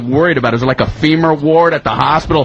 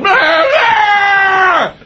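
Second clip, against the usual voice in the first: escalating mock moan. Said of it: "He's cranking it up".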